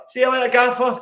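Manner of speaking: calling out. Retold or imitated. Fan calling goodbye to the manager through a crowd, cheerful shout. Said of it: "I shout".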